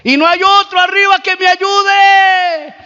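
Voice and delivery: high, projected yell upward; shouting for help. Retold or imitated. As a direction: call past the first voice for someone else. Climber shouts past God for help.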